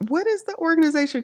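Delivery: squeaky quick question; high and fast. Real, not acted. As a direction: pitch it way up, rushed. Playful question, much higher.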